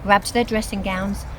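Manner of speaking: ordinary delivery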